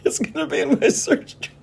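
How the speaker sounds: very high, laughing falsetto; giggling panic about the search history; laughing squeak. Real, not acted.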